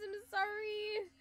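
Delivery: usual speaking voice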